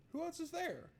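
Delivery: voce in falsetto, beffarda; squeaky mock voice.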